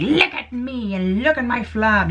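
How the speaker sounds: lightly voiced leprechaun; slow high leprechaun-ish voice. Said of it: "I don't know why it just sounded like the leprechaun".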